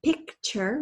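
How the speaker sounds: PICTURE as two distinct parts, "pick" and "chur"; both syllables clear. Shown as a demonstration. Teacher wanting both syllables heard, PICTURE carefully split in two. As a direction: separate "Picture" crisply between the k and the ch. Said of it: "hear both those syllables really well".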